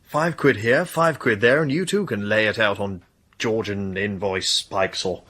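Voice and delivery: ordinary talk, nothing marked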